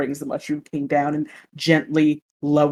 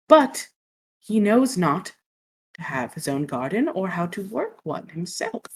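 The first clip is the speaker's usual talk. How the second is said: ordinary talk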